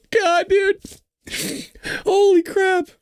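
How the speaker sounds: high voice, tearful with laughter; laughing in disbelief. Real, not acted. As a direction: exclaim through helpless laughter. Helpless laughter at the article.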